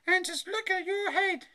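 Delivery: high, loud mum voice; alarmed mother. A narrator's reading. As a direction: exclaim at the child in a high pitch. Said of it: "she said".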